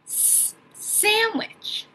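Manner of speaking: teacher isolating the s in sandwich; stretched first sound. Shown as a demonstration. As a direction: draw out the s, then "sandwich" slowly. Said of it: "I hear the sound s".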